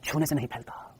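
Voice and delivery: slow, low and heavy announcer. Retold or imitated. Teacher mimics the TV ad on HIV for students, heavy voice. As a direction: imitate a solemn public-service ad, dropping low and soft. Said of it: "ऐसे भारी आवाज में".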